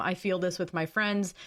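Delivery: ordinary talk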